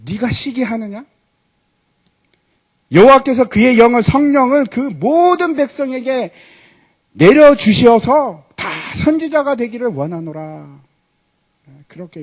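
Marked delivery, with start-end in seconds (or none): 0.1-10.8 slow, louder, higher solemn proclamation; solemn Moses. Retold or imitated. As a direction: proclaim it like a prophet, slowly. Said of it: "모세가 하는 말이 이겁니다". (모세가 장엄하게 천천히 선포하는 목소리)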